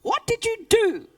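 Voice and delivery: put-on lively read-aloud voice for children; animated storytelling with character voices; story reading. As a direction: tell it like a bedtime story. Author reads her story aloud.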